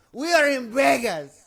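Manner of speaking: high-pitched cry of protest; mock aggrieved outburst. Angry stranger protests to the man apologizing, aggrieved. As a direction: imitate a worked-up little guy. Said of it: "I know he's pissed".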